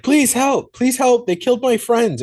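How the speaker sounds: high-pitched, frantic begging for help; panicked plea for help. Retold or imitated. Kid on the phone begging the deputy for help, high and frantic. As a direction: plead like a terrified kid on the phone. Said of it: "and the kid's like".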